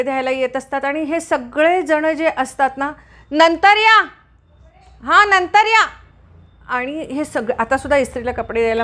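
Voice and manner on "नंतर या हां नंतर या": loud high call toward the door; calling loudly to someone at the door. Real, not acted. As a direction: shout it so they hear from inside.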